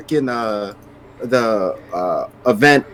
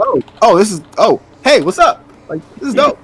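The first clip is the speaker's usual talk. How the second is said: high, flustered and excited; flustered excitement; 11 semitones higher, 4 dB louder than usual. Retold or imitated. Gamer retelling meeting a fan, high and flustered. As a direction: gush awkwardly at meeting him. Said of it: "And I was just like".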